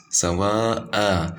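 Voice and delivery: Sawa'a with the final vowel held long; long mad. Shown as a demonstration. Teacher demonstrating the lengthened mad in Sawa'a. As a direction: stretch the end of Sawa'a. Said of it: "Why did we increase it like this?".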